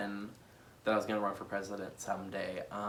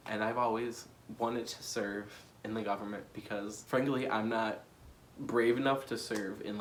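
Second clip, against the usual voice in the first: ordinary talk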